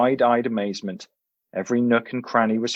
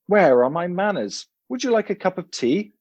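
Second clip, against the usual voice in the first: higher, polite British professor; fussy old English professor; 7 semitones higher than usual. A narrator's reading. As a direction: play the dotty host remembering his manners. Professor voice in story.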